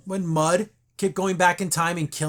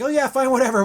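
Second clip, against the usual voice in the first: mock dismissive; 6 semitones higher, 5 dB louder than usual. Mariner's dismissive reply.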